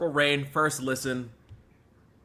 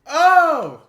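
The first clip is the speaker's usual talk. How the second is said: sudden cry; 16 dB louder than usual. Real, not acted. Emotional outburst after song.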